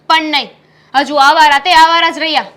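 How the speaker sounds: angry scolding. Acted. Wife scolds husband about loafing.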